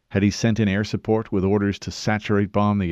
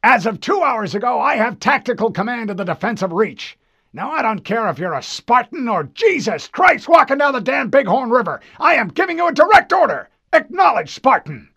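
high, loud, faster tirade; furious admiral; 8 dB louder than usual. Acted. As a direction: build into a furious demand. Admiral escalates order.

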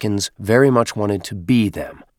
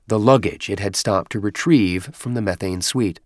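plain reading voice

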